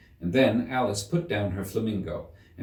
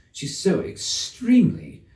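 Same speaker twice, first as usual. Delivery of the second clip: put-on higher girl's voice, slow, halted; Alice breaking off; 10 semitones higher, 4 dB louder, slower than usual. A narrator's reading. Girl criticising the Queen stops short, higher and slow. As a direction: stop yourself mid-word.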